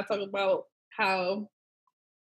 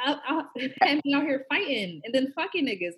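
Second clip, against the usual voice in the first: ordinary talk, nothing marked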